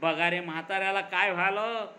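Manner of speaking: plain reading voice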